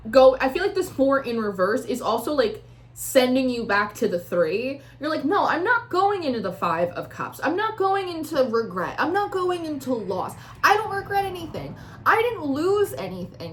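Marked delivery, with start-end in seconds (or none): 5.2-13.5 higher-pitched, emphatic denial; defiant refusal. Acted. Tarot reader voicing the querent refusing regret, emphatic defiance. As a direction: refuse point by point.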